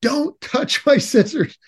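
high-pitched reenacted telling-off; upset scolding. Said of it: "I got really upset".

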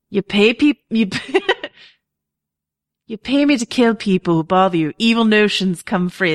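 a bad attempt at a rough Bronn accent; hammed-up Bronn, barely holding it; terrible Bronn impression. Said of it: "It's a terrible accent".

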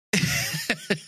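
a squeaky high laugh. Real, not acted. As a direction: burst into a high giggle. Laughs after grief talk.

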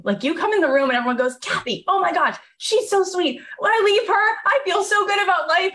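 high, bubbly imitation of adoring colleagues; gushing fan voices. Colleagues at the label gushing over Kathy, high and bubbly.

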